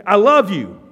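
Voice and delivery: loud, ringing I love you; impassioned declaration. Acted. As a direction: cry it out to the church. Christ voiced declaring love.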